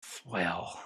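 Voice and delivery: very low, soft words through gritted teeth; gritted-teeth Clint. Retold or imitated. Seller imitates Eastwood between auction lots, low and very soft. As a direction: hiss it through the teeth like Dirty Harry. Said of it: "talking through his teeth".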